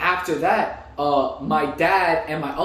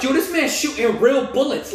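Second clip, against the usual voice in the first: pitched-up mock alarm; retold urgent protest; 6 semitones higher than usual. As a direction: plead urgently with a friend, voice raised high. Urgently arguing with friend, retold.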